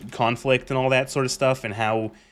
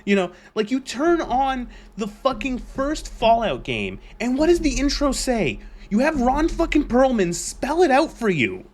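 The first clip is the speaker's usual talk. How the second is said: heated rant; 10 semitones higher than usual. Real, not acted. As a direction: vent at the fans. Ranting at Fallout fans.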